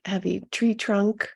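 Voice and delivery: ordinary delivery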